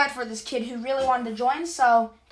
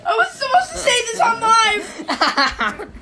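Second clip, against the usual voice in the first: mock panicked shout; 9 semitones higher, 8 dB louder, slower than usual. Mock panic after slip.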